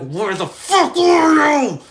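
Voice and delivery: slow, high, loud outraged shout; furious yell. Retold or imitated. Furious friends yelling at Jimmy who vanished. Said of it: "We were like".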